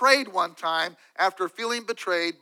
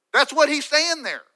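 much higher and louder, driving the point home; emphatic declaration; 8 semitones higher, 7 dB louder than usual. Real, not acted. Preacher drives David's point home, loud and high. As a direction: hammer the line out to the congregation.

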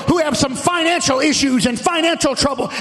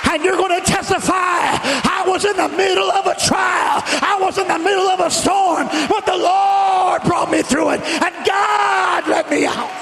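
full-force preaching; 4 semitones higher than usual. Real, not acted. Preacher urging the congregation to praise through trials, full-force preaching.

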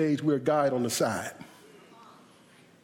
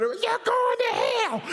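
very high screamed warning; mock hellfire screech; imitated preacher's scream; slower than usual. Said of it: "He started screaming".

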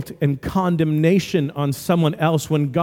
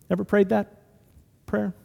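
ordinary talk, nothing marked

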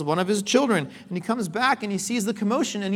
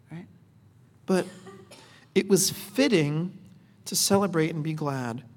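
calm a hurt son with kindness; tender, unhurried fatherly reassurance; gracious father; 3 semitones lower than usual. Retold or imitated. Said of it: "it's not angry... he's so gracious".

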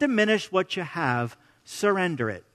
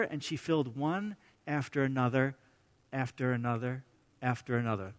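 each "after another" softer and lower, trailing away; fading repetition; 8 semitones lower, 13 dB quieter than usual. Shown as a demonstration. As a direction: let "after another" fade out like pouring without end. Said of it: "That's my words, not in there".

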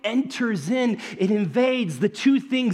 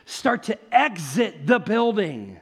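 normal talking voice

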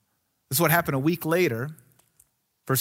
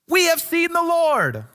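higher, drawn-out excited proclamation; excited announcement; 9 semitones higher, 4 dB louder than usual. Acted. Disciples telling Thomas about the Lord, excited. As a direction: announce it high and slow, thrilled.

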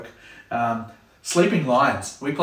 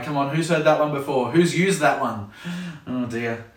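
ordinary talk, nothing marked

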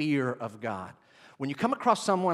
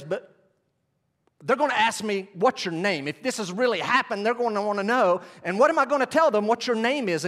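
voicing Moses; 7 semitones higher, 5 dB louder than usual. Moses pleading with God.

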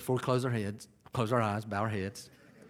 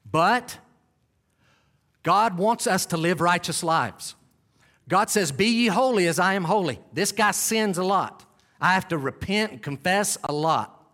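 high, forceful pulpit delivery; impassioned preaching; 6 semitones higher, 4 dB louder than usual. Real, not acted. Preacher confessing his own sins to the church, impassioned preaching. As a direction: drive it home to the congregation, loud and high.

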